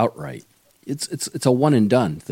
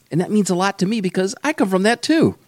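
excited; 10 semitones higher, 3 dB louder than usual. Real, not acted. Host delighted at shared background.

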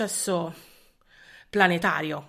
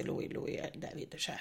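whispering; 4 semitones lower, 14 dB quieter than usual. Real, not acted. Swooning over David Beckham.

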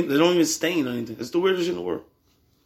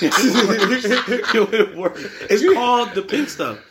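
laughing; 7 semitones higher, 4 dB louder, much slower than usual. Real, not acted. Raving about a cleaning product.

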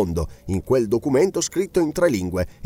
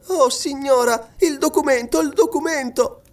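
put-on very high, urgent young voice; pleading girl; 14 semitones higher than usual. A narrator's reading. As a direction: implore her, pitched right up. Girl begs to see document.

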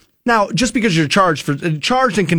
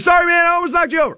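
high, fast blurted sorry; quick high-pitched sorry while grabbing someone's jacket; hurried apology; 12 semitones higher, faster than usual. Retold or imitated. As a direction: rattle off an apology in a rush.

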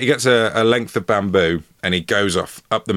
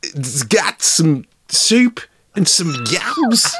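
hammed-up Scouse impression, high and slow; drawn-out, high-pitched comic Scouse; Scouse Christopher Walken; 9 semitones higher, much slower than usual. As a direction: lean hard into a silly Scouse accent. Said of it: "Is he Scouse Christopher Walken here?".

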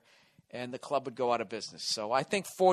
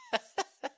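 short high chuckle; little high-pitched giggle; laugh; 10 semitones higher, 3 dB quieter than usual. Real, not acted. Host amused before his next question, high chuckle.

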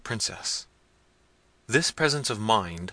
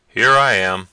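loud answering call at a normal pitch; calling back; 12 dB louder than usual. A narrator's reading. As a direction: project it firmly to those searching. Manfred answers the searching servants' call.

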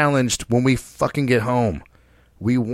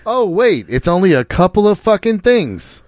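louder, higher "oh wait, only a couple"; higher, louder jokey backtrack; mock self-correction; 6 semitones higher, 5 dB louder than usual. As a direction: catch yourself in a joking panic.

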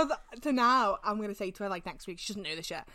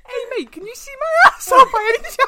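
high, loud, fast mock question; mock bloke asking; 14 semitones higher, 12 dB louder, faster than usual. Mate hamming up a question to his friends, high and loud. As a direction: ham up a cheeky bloke calling out.

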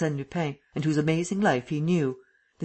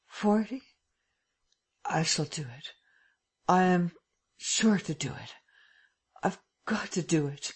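desperate resolve; 3 semitones higher than usual. A narrator's reading. Lupin urging himself on, strained and desperate. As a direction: push yourself on through weakness.

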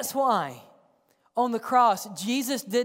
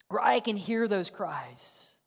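normal talking voice

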